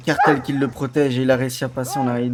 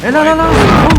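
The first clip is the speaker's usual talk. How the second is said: high, loud panicked yell; panicked shout; 10 dB louder than usual. Real, not acted. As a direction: stop them in a rush. Panics to stop the firing.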